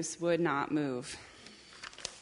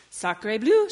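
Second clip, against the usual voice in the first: loud, higher French duck exclamation; French-accented cry; 4 semitones higher, 10 dB louder than usual. Acted. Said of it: "she cried".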